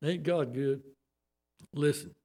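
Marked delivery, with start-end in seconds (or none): none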